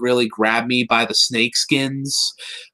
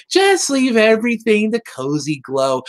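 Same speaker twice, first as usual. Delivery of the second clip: imitated Cozy Glow; 6 semitones higher than usual. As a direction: play a too-sweet little schemer. Imitating Cozy Glow offering help.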